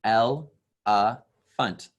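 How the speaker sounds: "El, a, funt" as three separate beats; syllable by syllable. Shown as a demonstration. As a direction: break the word "elephant" into three spoken beats. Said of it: "elephant has three".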